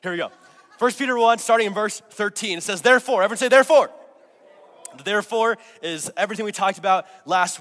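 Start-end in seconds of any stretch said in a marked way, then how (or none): 0.8-2.6 louder and higher, calling out the passage; loud announcement. Real, not acted. Pastor announcing the passage to the church, loud and high. 2.8-3.2 shouted, high and loud; called out. Real, not acted. Pastor calling the key word to the church, loud. 3.5-3.9 shouted, high and loud; called out. Real, not acted. Pastor calling the key word to the church, loud. Preacher leads call-and-response.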